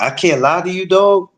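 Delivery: ordinary delivery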